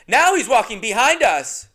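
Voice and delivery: put-on high, loud little boy; bratty kid voice. The kid pointing at the comedian in the hallway, high and loud. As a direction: blurt it like a tactless kid.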